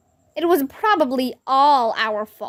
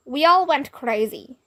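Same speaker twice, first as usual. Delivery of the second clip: ordinary delivery, nothing marked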